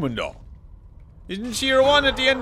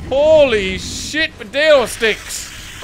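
slow stunned exclamation; 2 semitones higher, much slower than usual. Real, not acted. Stunned reaction, words stretched out.

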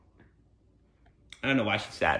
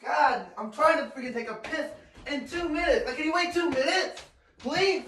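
high, softer whiny complaint; exasperated plea; 6 semitones higher, 6 dB quieter than usual. Real, not acted. Person begging for two minutes in the bathroom, exasperated plea. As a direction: plead in a high, put-upon voice. Pleading to be left alone.

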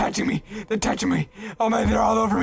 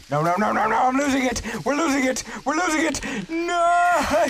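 rising panicked cries, pitched higher; 7 semitones higher than usual. Pretend. Gamer watching the ball roll back, rising panic. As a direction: lose it as the ball slips away.

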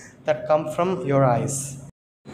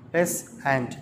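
slow "peasant" in two separate beats; syllable by syllable. Shown as a demonstration. Teacher counting syllables for children, "peasant" slowly split in two. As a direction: break "peasant" into pea and sant with a pause. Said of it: "two syllables".

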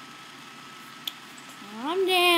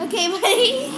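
laughing; 7 semitones higher than usual. Real, not acted. Laughing while dog plays.

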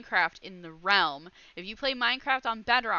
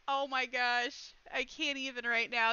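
normal talking voice

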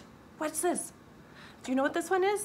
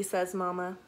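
usual speaking voice

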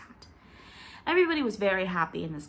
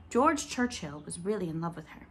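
ordinary delivery, nothing marked

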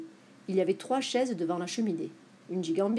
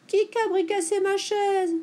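put-on high whining baby bear voice; high-pitched whimpering complaint; tearful little bear; 11 semitones higher, 4 dB louder than usual. A narrator's reading. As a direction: wail it like a crying baby bear. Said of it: "pleure Chignache Petit Ours".

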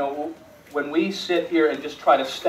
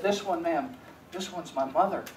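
boy's words voiced soft and hushed with a touch of tears; tearful hush; 11 dB quieter than usual. Boy crying, tells the doctor it's his mother, soft and tearful. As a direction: let it drop almost to nothing, holding back tears.

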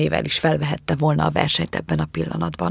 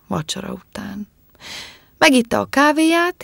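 ordinary delivery, nothing marked

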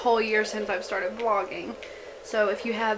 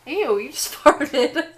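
loud laughing disgust, slow; 5 semitones higher, 7 dB louder, slower than usual. Real, not acted. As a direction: laugh out a grossed-out ew. Husband farted.

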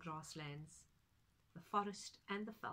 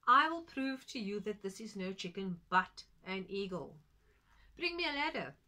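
loud, resolute challenge; determined; 9 dB louder than usual. Acted. Friend challenges farmer.

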